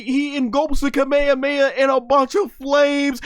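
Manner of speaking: hyped shouting. Retold or imitated. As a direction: rant like a fan losing his mind. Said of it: "and I'm like".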